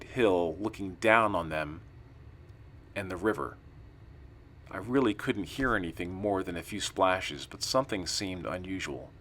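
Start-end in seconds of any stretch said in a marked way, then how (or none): none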